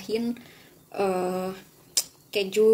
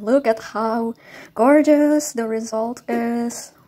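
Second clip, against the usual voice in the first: excited; 3 semitones higher, 6 dB louder, much slower than usual. Real, not acted. Admiring finished dish.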